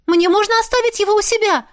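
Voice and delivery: very high, eager question; thrilled girl asking. A narrator's reading. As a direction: ask excitedly in a high girlish voice. Overjoyed girl asks to keep it.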